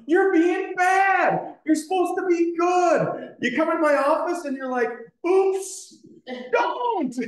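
very high-pitched mock scolding; hammed-up frustrated squawk; Honest Preacher. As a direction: imitate a preacher losing it at the congregation. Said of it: "the Honest Preacher gets up there".

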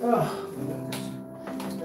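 ordinary delivery

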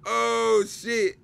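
loud, high shocked outburst; surprised. Real, not acted. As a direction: blurt it out in sudden shock. Sudden exclamation at definition.

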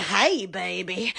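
mock the smooth pickup line, low and slow; flirty Instagram guy. Imitating Instagram men hitting on her, low slow croon. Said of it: "They keep saying".

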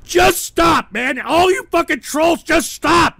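high, loud exasperation; fed-up yelling; angry pleading shout. Real, not acted. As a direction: yell at hecklers to quit. Yelling at trolls to stop.

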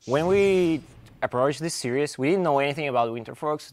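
a bit hoarse, voice half gone; strained, slightly hoarse speech. Real, not acted. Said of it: "Sorry, I lost my voice a bit".

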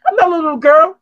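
mock defiant girl. Retelling how the woman protested, high and mock-defiant. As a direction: play her pouty defiance in a squeaky voice. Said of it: "aggressive and defiant, like".

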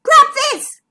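loud, high urgent call. A narrator's reading. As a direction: call across the water urgently. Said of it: "called McMeer".

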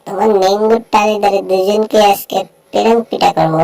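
normal talking voice

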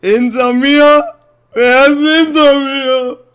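very high, very loud slow screams ending in a shriek; terrified screaming. Real, not acted. Gamer hearing something behind him, screaming in terror.

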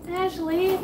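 high, drawn-out gushing; awed, high-pitched excitement; amazed delight. Real, not acted. Thanking gift sender, overwhelmed.

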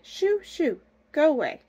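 high-pitched shooing of kittens; shooing old woman. Acted. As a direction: shoo them off in a high voice.

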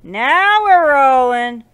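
loud, drawn-out triumphant call; triumphant cheer. Real, not acted. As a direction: crow it slowly at full volume. Gamer celebrates success out loud.

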